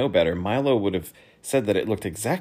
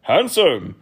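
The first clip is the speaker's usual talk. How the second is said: louder courtly voice; Count's suggestion; 6 semitones higher, 7 dB louder than usual. Acted. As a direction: recommend it gravely. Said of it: "recommended the Count".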